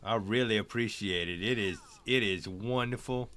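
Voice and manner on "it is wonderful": normal talking voice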